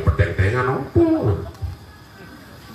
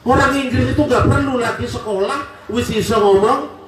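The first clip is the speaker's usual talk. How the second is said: fiery preaching; 11 semitones higher than usual. Acted. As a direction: preach it up at full force to the crowd. Preacher rouses the congregation. (ceramah berapi-api dengan suara tinggi dan lantang)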